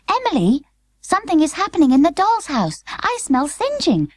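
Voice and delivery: put-on a lightly high, worried girl; worried little girl. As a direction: call her sister in alarm. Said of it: "said Charlotte, sniffing".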